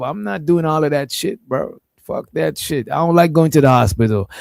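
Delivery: usual speaking voice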